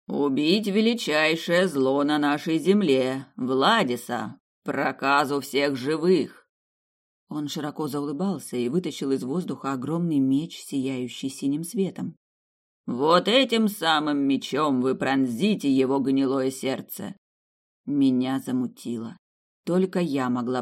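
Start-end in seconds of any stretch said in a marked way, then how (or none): 0.1-6.5 slow, loud solemn decree; solemn old priest. Acted. Priest Plas speaking. 12.9-17.2 slow, loud solemn decree; solemn old priest. Acted. Priest Plas speaking.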